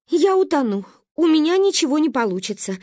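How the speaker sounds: a frightened woman barely catching breath; breathless fear. Acted. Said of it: "еле отдышалась мама".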